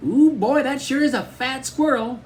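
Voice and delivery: suara adik kecil yang jauh lebih tinggi; little brother Cody. A narrator's reading. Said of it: "Cody laughed and said".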